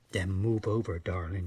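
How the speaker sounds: low, soft come-on; seductive charmer. Acted. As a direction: purr it like a beach Romeo. Husky chap makes his move.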